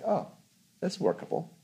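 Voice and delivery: high, soft voice of dawning relief; sudden realization. Retold or imitated. Meditator after acknowledging the freak-out, sudden realization. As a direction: lift the pitch lightly, as if retelling a discovery. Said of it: "And so suddenly it's like".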